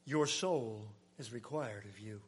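low, hushed and grave; God's solemn verdict. Retold or imitated. God passing sentence on the rich man, hushed and grave. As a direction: let the verdict fall low and quiet. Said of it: "God said".

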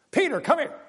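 high call to summon someone. Acted. God summoning Peter to see the offer, mock high call. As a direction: call him over, high and urgent.

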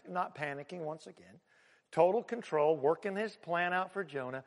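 normal talking voice

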